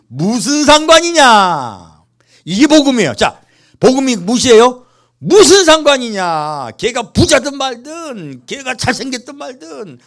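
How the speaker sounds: loud, slow, high preaching; full-force preaching. Acted. Pastor proclaiming the gospel to the congregation, loud slow preaching. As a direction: drive each line home to the pews.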